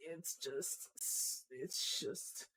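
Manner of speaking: breathless whisper. Real, not acted. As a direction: barely voice it. Speechless at the icons.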